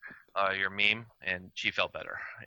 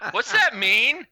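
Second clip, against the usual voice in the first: put-on high, louder child's question; little girl; 14 semitones higher, 8 dB louder, much slower than usual. Little girl asks her mother about the slogan, high childlike voice. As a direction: imitate a small child asking mommy. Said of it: "the little girl turns around".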